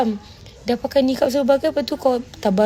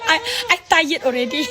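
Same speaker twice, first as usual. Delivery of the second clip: real laugh spilling through the words, pitched up; laughing tired; 6 semitones higher than usual. Real, not acted. Host laughing at a viewer that she's too tired. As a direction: giggle it out, worn out and amused.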